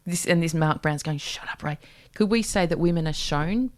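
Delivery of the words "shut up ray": whispered mock warning; soft, breath-only imitation; hushed aside. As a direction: say it under your breath as someone else's plea. Acts out Mark Brown's aside.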